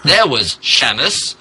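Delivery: normal talking voice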